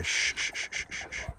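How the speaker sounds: soft breathy shush. Real, not acted. Owner shushing the dogs to calm them, soft. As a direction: settle the dogs. Hushing the animals.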